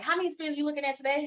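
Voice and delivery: quick, high teasing client voice. Retold or imitated. Client teasing her about her screens, quick and high. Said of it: "my clients will say".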